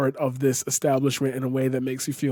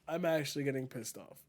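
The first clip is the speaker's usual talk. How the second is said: soft, muttered repeat of "actually"; quiet aside; 11 dB quieter than usual. Real, not acted. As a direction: say it again softly to yourself.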